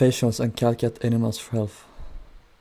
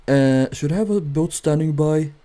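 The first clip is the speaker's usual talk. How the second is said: higher, louder dim-witted radio question; imitating radio operator; 5 semitones higher, 5 dB louder than usual. Operator asking the sinking ship, mock clueless. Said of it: "They were like".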